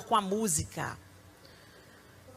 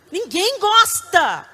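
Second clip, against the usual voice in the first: higher, louder and slow; emphatic; 9 semitones higher, 8 dB louder, much slower than usual. Real, not acted. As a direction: hammer it home. Emphatic preacher answer. (enfático)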